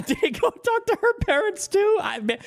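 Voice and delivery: incredulous laugh breaking through. Real, not acted. Streamer laughing at the president's story.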